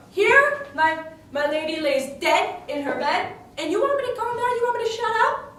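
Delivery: high, indignant outburst; rising fury. Acted. Furious argument with Othello.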